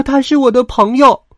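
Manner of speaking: very high, loud lamb voice; little lamb protesting. A narrator's reading. Little lamb objects to mother about the friend, high loud protest. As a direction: squeal an indignant protest as a small lamb. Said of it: "小羊跺着脚抗议".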